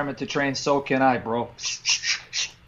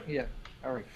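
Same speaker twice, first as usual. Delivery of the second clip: usual speaking voice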